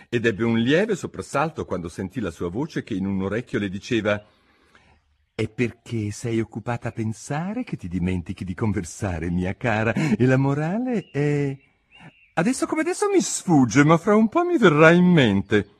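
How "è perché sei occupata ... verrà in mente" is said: higher, louder, pompous duchess. A narrator's reading. Duchess lectures Alice, pompous and higher. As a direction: preach a moral she cannot recall.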